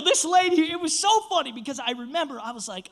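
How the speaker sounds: ordinary talk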